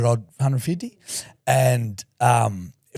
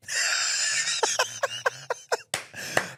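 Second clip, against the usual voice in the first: high-pitched laugh; 5 dB quieter than usual. Real, not acted. Shrill laugh.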